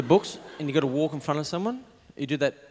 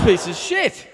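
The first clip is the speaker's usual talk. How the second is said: loud, higher mock outburst; 8 semitones higher, 8 dB louder, slower than usual. Comic voicing someone who hates their present, loud mock outburst. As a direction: blurt it out loud, playing disgusted. Mimed reaction to bad present.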